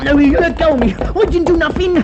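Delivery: put-on a young man seized by the inspector, protesting in fear; frightened protest. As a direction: struggle and plead your innocence. Suspect struggling while held.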